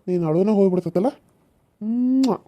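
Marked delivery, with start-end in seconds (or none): 1.8-2.4 drawn-out smacking kiss; kiss sound. Acted. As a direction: plant a long noisy "mwah". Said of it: "ನಾನು ಮುತ್ತು ಕೊಟ್ರೆ ಸಾಕು".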